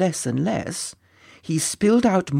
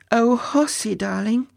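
cooing princess; 6 semitones higher than usual. Acted. Princess coos her husband's pet name.

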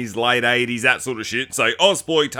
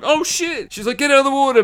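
mother calling the toddler out of the water, high mock yell; mock panicked call; 10 semitones higher than usual. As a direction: yell for him to get out.

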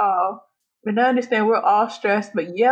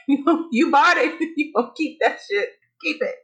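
higher voice broken by laughter; laughing mock refusal; 5 semitones higher than usual. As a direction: laugh through a mocking refusal. Mocking store's reply, laughing.

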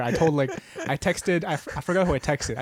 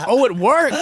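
laughing, loud, high delight; 3 semitones higher, 5 dB louder than usual. Retold or imitated. Boy learning his friend covered for him, delighted and laughing. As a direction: crow with glee that it worked. Delighted the skipping trick worked.

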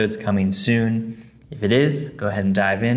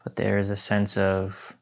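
guided meditation. Acted. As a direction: guide them gently into stillness.

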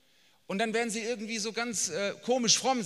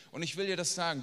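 ordinary talk, nothing marked

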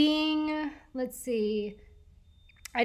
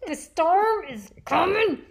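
mock ominous; 5 semitones higher, 4 dB louder than usual.

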